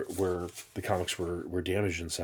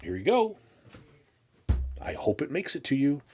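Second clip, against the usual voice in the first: cheery sender voice; 7 semitones higher, 4 dB louder than usual. Retold or imitated. Voicing the sender mailing comics.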